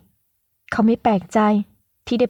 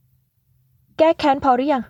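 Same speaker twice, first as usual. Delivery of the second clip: challenging; 5 semitones higher, much slower than usual. Acted. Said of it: "ทำหน้าท้าทาย".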